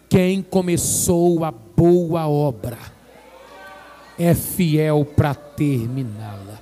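very slow, loud emphatic promise; slow solemn declaration. Real, not acted. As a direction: stretch every word, proclaim it. Declaração lenta e solene. (declaração lenta e solene)

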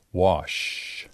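normal talking voice

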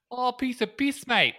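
high, loud mock-Kiwi hobbit; little Bilbo. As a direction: put on a high little voice with a bad New Zealand accent. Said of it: "says little Bilbo".

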